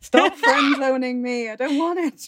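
loud, high, drawn-out comic whine; mock wailing. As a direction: wail it like a hammed-up heartbroken suitor. Mock-voices his rejection of friendship.

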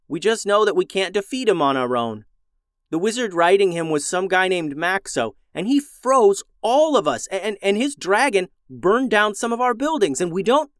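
put-on high, urgent kid voice; pleading boy, voice rising; excited boy voice. Milo's voice, high and eager.

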